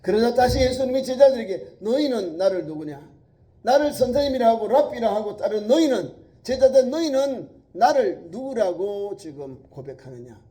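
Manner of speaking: loud, high, forceful preaching; preaching at full force. Acted. Preacher thundering Jesus' question to the disciples, full force. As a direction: press the disciples hard.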